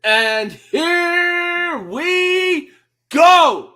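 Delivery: very slow, high, loud stretched call; drawn-out announcer shout. Pretend. Fan opening round one with a long, loud mock announcer shout. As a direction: belt it like the round is starting.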